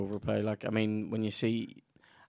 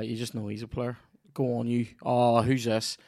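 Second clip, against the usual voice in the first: ordinary talk, nothing marked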